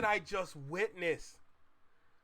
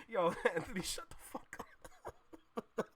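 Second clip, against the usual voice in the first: laughing whisper; 8 dB quieter than usual. Real, not acted. Laughing at chatter Anthony's joke.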